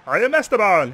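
loud, beaming Esteban name call; happy Esteban. Pretend. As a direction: announce yourself like a proud winner. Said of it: "He's happy".

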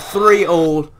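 shouted score in dismay; loud, high outburst; shouting. Real, not acted. Goal conceded, shouting the score.

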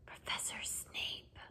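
slow, very soft whisper; barely a whisper. A narrator's reading. Frightened pupil naming what scares him most, whispered. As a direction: whisper it almost without sound. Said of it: "barely more than a whisper".

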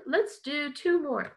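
usual speaking voice

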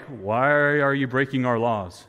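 low, drawn-out mock reproach; mock disapproving leaders. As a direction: imitate the leaders, slow and low. Mocks the leaders' dull reaction.

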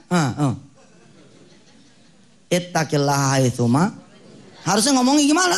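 ordinary talk, nothing marked

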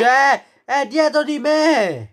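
high, loud panicked shout; being chased. Pretend. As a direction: yell that it's right behind you. Piggy right behind him.

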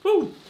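high loud whoop of joy; joyful whoop. Real, not acted. Celebrating the kill, joyful whoop. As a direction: let out a victory whoop.

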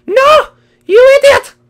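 a wounded cry of protest; crying outburst. Pretend. Interrupts friend defending father.

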